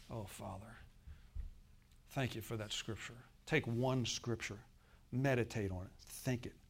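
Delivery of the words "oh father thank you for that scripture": much softer, a gentle bedtime prayer; hushed prayer. Shown as a demonstration. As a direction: pray it almost under your breath. Models a quiet bedtime prayer.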